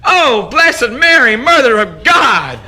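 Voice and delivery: high, slow, loud shout. Acted. Myrtle shouting her prayers in bed, high slow shout. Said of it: "she shouted".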